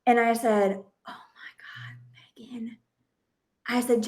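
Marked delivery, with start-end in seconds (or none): 1.1-2.8 whispered, much softer aside; shocked whisper. Retold or imitated. Mom stunned by her son's apology, whispering to herself. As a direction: breathe it out in disbelief.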